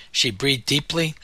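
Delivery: usual speaking voice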